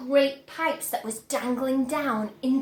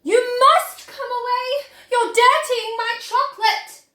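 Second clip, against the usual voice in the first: high, loud frantic pleading; frantic plea; 9 semitones higher, 7 dB louder than usual. Acted. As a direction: urge him away in a panic. Wonka hopping and pleading.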